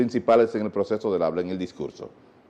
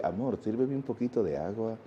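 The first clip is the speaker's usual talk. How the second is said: soft, caressing ask; tender request; 9 dB quieter than usual. Retold or imitated. Husband gently asking his wife for water, soft and caressing. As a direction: stroke her with your voice. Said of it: "Te acarició con la voz".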